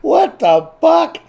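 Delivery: very high, slow, loud outburst of disbelief; stunned exclamation. Retold or imitated. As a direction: stretch it out in total shock. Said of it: "I was like".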